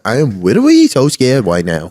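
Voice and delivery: put-on babyish w-for-r and l delivery; hammed-up oddball voice; comic put-on accent. Man from the TikTok trend, comic babyish accent. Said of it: "I've been saying that accent all weekend".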